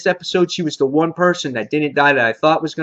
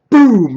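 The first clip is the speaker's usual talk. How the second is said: loud, higher gunshot boom; 6 semitones higher, 7 dB louder than usual. Retold or imitated. Fan recapping the headshot shouts a loud boom. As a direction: punch it like a gunshot.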